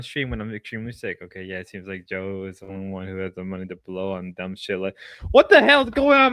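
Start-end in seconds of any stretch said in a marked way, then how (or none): 5.3-6.3 high, loud "what the hell"; shocked outburst. Real, not acted. Streamer shocked by what chat says, high and loud. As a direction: blurt it in disbelief.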